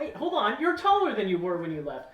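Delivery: high, loud innkeeper voice; surprised landlady exclaiming; piercing voice. Acted. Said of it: "and she's like".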